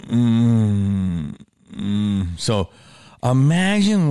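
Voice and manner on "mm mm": long, held "mm" groan; queasy closed-mouth moan; suffering hum. Retold or imitated. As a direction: hum through the misery, acted. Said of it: "I'm just literally going".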